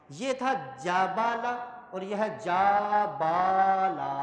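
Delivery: very slow, each vowel length marked; slow teaching comparison of short and long; slow contrast. Shown as a demonstration. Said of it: "ادائیگی میں یہ فرق ہے".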